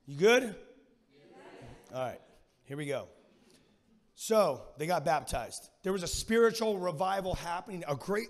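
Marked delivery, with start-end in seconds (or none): none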